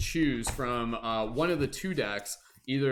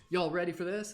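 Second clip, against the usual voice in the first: higher, hyped-up call to the crowd; hype announcer voice; 5 semitones higher than usual. Acted. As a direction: pump up the audience. Hyping the chat before game.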